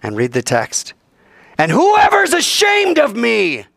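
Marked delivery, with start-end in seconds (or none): 1.6-3.7 high, loud, slow warning; loud, slow pulpit warning; preached shout. Acted. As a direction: thunder the verse out. Preacher reading text aloud.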